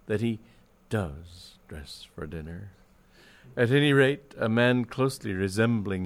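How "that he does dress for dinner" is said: solemn pause, then a low-key finish; grave. A narrator's reading. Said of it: "said Scollop gravely".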